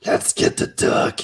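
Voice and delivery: slow, voiceless action-hero growl; Rambo-like tough guy. Acted. Action hero setting out to rescue dogs, hammed-up tough. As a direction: say it slowly through a knife in the teeth. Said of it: "a Rambo-like guy put a knife in his teeth".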